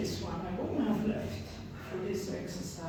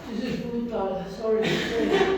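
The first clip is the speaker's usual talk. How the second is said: laughing; 5 semitones higher, 8 dB louder than usual. Real, not acted. Exhausted after hard exercise.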